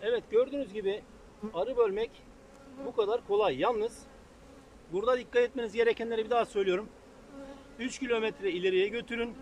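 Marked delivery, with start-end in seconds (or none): none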